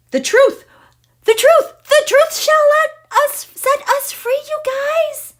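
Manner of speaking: very high, ringing cry of triumph; real overjoyed, high-pitched outburst; elated exclamation. Real, not acted. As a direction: proclaim it at the top of your range. Exclaiming over truth in the chart.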